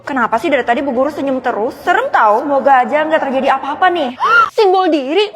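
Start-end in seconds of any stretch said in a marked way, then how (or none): 4.3-5.3 slow, louder and higher after a gasp; gasping surprise. Acted. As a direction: caught off guard by the box. Gasp opening mystery box.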